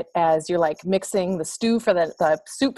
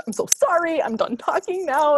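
flustered apology; 10 semitones higher than usual. Retold or imitated.

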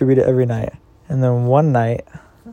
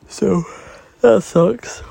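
mock whine; 6 semitones higher, 4 dB louder, much slower than usual. Real, not acted. Mock complaint after whoopsies.

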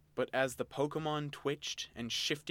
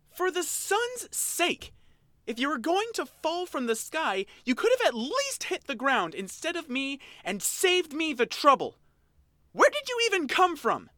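roared; 9 dB louder than usual. Acted. Oshawott roaring at the girl who fell on him, high and loud. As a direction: bellow it at her, high and furious.

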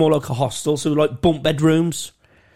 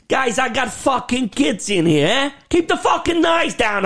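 hammed-up Brooklyn accent, pitched much higher, building to a shout; dodgy Brooklyn accent; 10 semitones higher than usual. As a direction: fake New York tough guy yelling about his kids. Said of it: "a dodgy fucking Brooklyn, New York accent".

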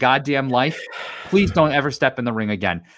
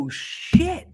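a high, breathless oh shit; excited gasp. Real, not acted. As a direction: gasp it out in delight. Reacting to fight news.

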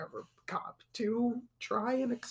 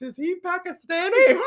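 Jerry Lawler; 7 semitones higher, 8 dB louder than usual. Retold or imitated. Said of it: "Jerry Lawler asks".

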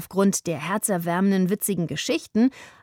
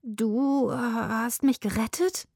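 stammering; 4 semitones higher, slower than usual. Acted. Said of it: "stammelte Maximilian".

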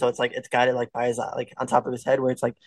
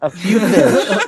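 laughing; 5 semitones higher, 6 dB louder, much slower than usual. Real, not acted. Joking add-on, laughing.

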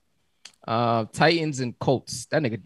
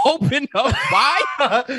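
high, slow, hammed-up coaxing; mock waiter; 12 semitones higher, 4 dB louder, much slower than usual. Laughing friend voicing the chef, coaxing. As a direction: tease it out like feeding a child. Salt Bae feeding joke.

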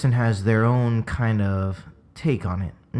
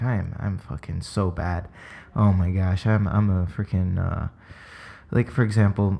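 ordinary talk, nothing marked

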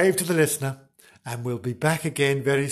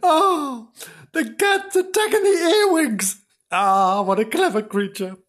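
hammed-up delighted admiration; beaming delight; 11 semitones higher than usual. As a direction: marvel aloud, starting high then settling. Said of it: "Mister Flange beamed at her".

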